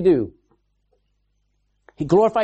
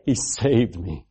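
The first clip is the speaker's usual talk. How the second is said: quiet wonder; 10 semitones lower, 3 dB quieter, slower than usual. Acted. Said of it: "just stopping and saying".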